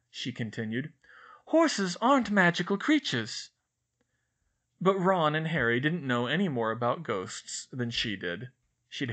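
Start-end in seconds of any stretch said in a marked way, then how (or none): 1.4-3.5 put-on higher, far brighter girl's voice, fussy and earnest; Hermione voice. A narrator's reading. Narrator voices Hermione complaining to Ron and Harry, higher girl voice. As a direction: play a know-it-all schoolgirl fretting.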